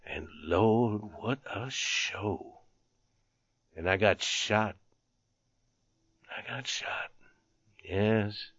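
low, soft, halting murmur; weakly marvel, with long pauses; dazed surprise. A narrator's reading. Wounded soldier who doesn't know how it happened, dazed. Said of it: "repeated this fact in a surprised way".

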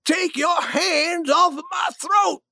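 high, slow, strained cry; acted shout, drawn out; outraged protest. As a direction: demand release from a chokehold. King being choked by duke.

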